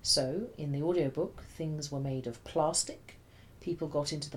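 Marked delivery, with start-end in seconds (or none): 2.4-3.2 PLASTIC with an exaggerated long "ah", as "plahstic"; mock British vowels. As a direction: slowly stretch the first vowel of "plastic" into a mock-British "aah". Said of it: "must make use of only long vowel sounds".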